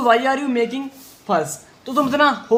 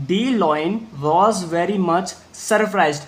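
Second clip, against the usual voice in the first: slow, word by word reading; slow read-aloud; 2 semitones lower, much slower than usual. Shown as a demonstration. Teacher reading slowly to the class. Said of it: "ध्यान से देखिए".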